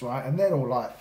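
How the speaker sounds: usual speaking voice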